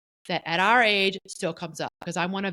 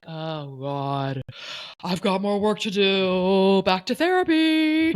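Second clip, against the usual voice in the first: mock despair; 5 dB louder, much slower than usual. Self-mocking quote of her own reaction.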